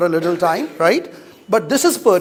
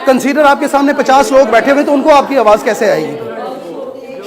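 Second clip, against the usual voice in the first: loud, forceful calls to speak up; shouted coaching; 3 semitones higher, 3 dB louder, much faster than usual. Real, not acted. Teacher pushing student to project, loud shouting. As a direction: shout across the hall to make her louder.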